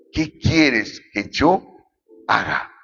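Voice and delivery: slow, fading question in mild awe; trembling and fearful. A narrator's reading. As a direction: let the question sink away, understated fear. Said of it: "él temblando y temeroso dijo".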